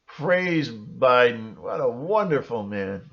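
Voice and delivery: normal talking voice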